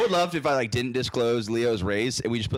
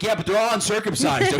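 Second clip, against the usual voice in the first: defensive; 8 semitones higher, 3 dB louder than usual. Retold or imitated. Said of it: "I'm like".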